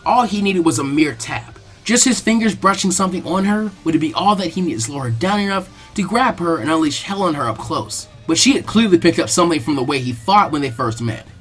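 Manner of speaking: ordinary delivery, nothing marked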